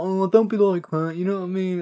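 smarmy Barry. Retold or imitated. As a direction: sweet-talk her like a sleazy boyfriend. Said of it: "And Barry's like".

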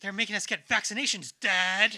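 put-on loud, whiny teen; teen angst. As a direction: whine it at Dad like a teen. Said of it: "The teenage angst".

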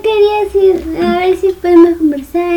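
high, whiny imitation of her; vocecita imitada. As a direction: mimic her nervous explanation in a high voice. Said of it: "Cualquiera estaría con esa voz". (imitándola con vocecita aguda)

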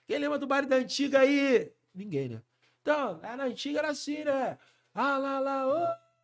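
loud, high call to the crowd; MC hype call. Retold or imitated. An MC on stage calling out to the funk party crowd, loud. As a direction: pump up the crowd on the mic.

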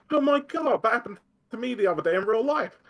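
high-pitched, hammed-up exclamation; mock amazed reaction. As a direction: blurt it out loud and high in mock astonishment. Acted excited reaction to the game.

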